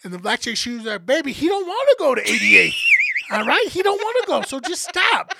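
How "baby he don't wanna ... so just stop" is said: imitated high, loud, sassy telling-off; scolding coworker. Receptionist defending patient from a pushy coworker, mock scolding. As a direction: shut a coworker down in a raised, high-pitched voice.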